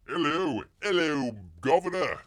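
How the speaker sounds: loud, high hammed-up Cockney greeting; mock British accent. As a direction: crow it in exaggerated Cockney. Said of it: "that's your British franchise there".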